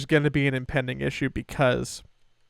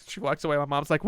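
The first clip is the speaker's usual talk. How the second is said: normal talking voice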